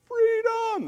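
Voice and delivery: high, joyful shout of release; gleeful freedom cry. Retold or imitated. Parents without kids cheering, high gleeful cry. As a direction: belt it out like parents let loose. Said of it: "We're like".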